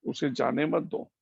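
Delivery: ordinary delivery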